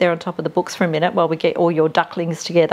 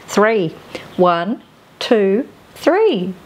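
ordinary talk

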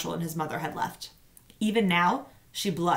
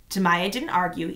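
usual speaking voice

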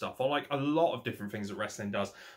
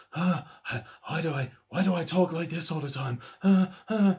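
higher, breathy-panting mock moan with huffs; Kenny Omega impression; 5 semitones higher, 3 dB louder than usual. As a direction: pant between words like a man on the edge. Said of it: "Like he's literally on the edge of orgasm".

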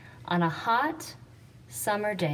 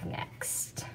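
whisper; 10 dB quieter than usual. Real, not acted. Teacher whispers aside.

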